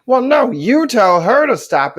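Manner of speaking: loud, high, drawn-out indignant retort; mock-outraged comeback; arguing old person. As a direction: snap back slowly like a quarrelling senior. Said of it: "They were arguing over".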